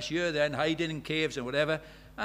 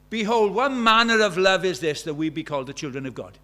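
proclaiming behold; 2 semitones higher, 9 dB louder than usual. Acted. Preacher reading John's cry to the church, loudly. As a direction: call them to stop and look. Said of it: "Stop, look, this is a big deal".

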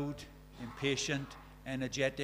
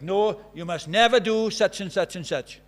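loud, stern telling-off with a punched never; strict teacher scolding pupils, loud and firm; stern teacher warning; 2 semitones higher, 8 dB louder than usual. Retold or imitated. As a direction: lay down the law to the class.

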